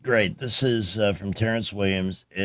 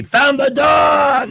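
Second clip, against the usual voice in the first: excited shout; 10 dB louder, much slower than usual. Real, not acted. Announcing the lost dog found.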